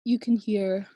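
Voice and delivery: ordinary delivery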